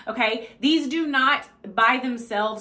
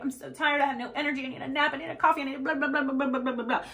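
higher, run-on whiny self-talk; mock complaining; 3 semitones higher, faster than usual. Mimicking the listener's negative self-talk, mock complaining. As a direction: rattle off complaints into dismissive blahs.

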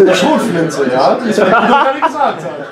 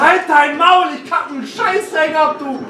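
shouting; 6 semitones higher than usual. Real, not acted. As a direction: shout back at your teasing buddy. Playful insult while gaming.

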